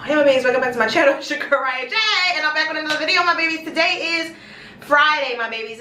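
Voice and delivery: quick run-through of the channel greeting; rapid intro rerun. Real, not acted. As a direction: rattle off the vlog greeting. Runs through intro a second time.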